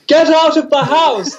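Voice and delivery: high, drawn-out cracking yell. Retold or imitated. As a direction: yell it with your voice jumping way up. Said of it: "my voice went, like, really high".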